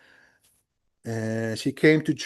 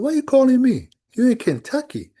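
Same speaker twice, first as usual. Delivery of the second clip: higher, louder, surprised question; incredulous question; 11 semitones higher, 4 dB louder than usual. Retold or imitated. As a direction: ask as if caught off guard. Retold phone call with friend.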